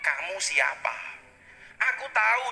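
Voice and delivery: ordinary talk, nothing marked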